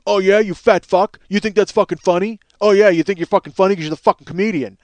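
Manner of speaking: loud, aggressive mic taunt; angry taunt. Retold or imitated. Karaoke host berating a comedian on the mic, angry taunt. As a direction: taunt him loudly, imitating an angry host.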